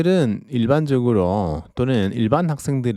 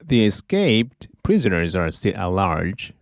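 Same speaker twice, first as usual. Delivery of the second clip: slow teaching read; much slower than usual. Shown as a demonstration. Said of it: "teacher models sentence slowly".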